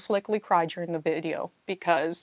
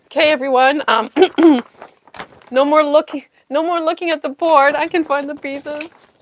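loud, higher, playfully bossy call to everyone; mock bossy announcement; 5 semitones higher, 7 dB louder than usual. Puzzler telling chat to stop looking, mock bossy. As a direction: hush the room like a teacher, hammed up. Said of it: "I'm like".

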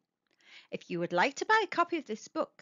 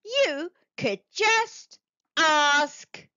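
relieved octopus; 9 semitones higher, 3 dB louder than usual. Acted. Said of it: "said Octopus".

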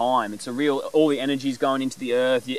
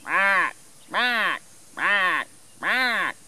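higher crow caw, lightly voiced; 5 semitones higher, much slower than usual. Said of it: "he's like".

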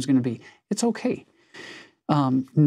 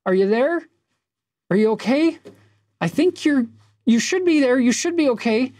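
anxious king; 10 semitones higher than usual. Retold or imitated. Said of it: "He's like".

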